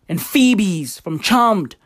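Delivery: ordinary delivery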